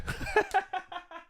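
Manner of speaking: high, quiet giggle; trailing high-pitched laughter; laughing. Real, not acted. Man amused after asking why they're here, high soft giggle.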